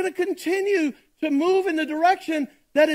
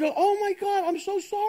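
higher, softer, imitating a sheepish driver; mock apology; 6 semitones higher, 4 dB quieter than usual. Driver caught by a cop, mock sheepish apology. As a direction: play the caught driver begging off, lightly. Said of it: "And then your attitude".

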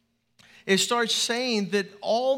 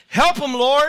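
loud, higher call rising at the end; congregation call-out; 6 semitones higher, 10 dB louder than usual. Shown as a demonstration. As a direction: call it out like a church response.

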